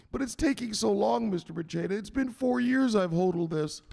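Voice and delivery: high-pitched pleading protest; complaining victim. Acted. Victim protesting to the enforcer, high pleading. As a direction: plead your case in a high voice.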